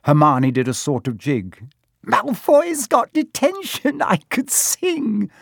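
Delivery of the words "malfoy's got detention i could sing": very high, slow, elated squeal; gleeful singsong. A narrator's reading. Hermione doing a jig over Malfoy's detention, gleeful. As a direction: crow it in sheer delight.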